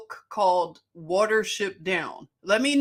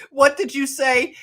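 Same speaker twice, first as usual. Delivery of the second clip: normal talking voice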